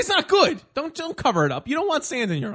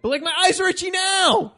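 complaining shout; 12 semitones higher, 6 dB louder than usual. Real, not acted. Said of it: "You don't know what it's like".

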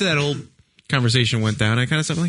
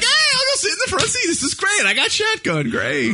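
high, bright fake-cheerful voice; cheery high voice. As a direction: sound thrilled about shotgun, hammed up. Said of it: "I'm like".

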